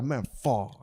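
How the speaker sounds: put-on understated Puerto Rican accent catchphrase; imitated Puerto Rican accent. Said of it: "I say it all the time".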